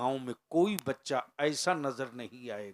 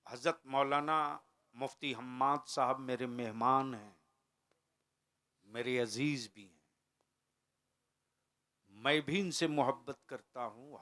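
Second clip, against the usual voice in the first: usual speaking voice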